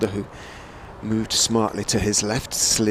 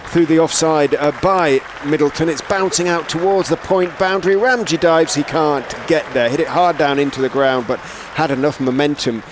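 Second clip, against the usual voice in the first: raised, louder cricket commentary; live play-by-play; 6 semitones higher, 7 dB louder than usual. Real, not acted. Commentator following the ball to the point boundary, excited play-by-play. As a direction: call the shot as it races away.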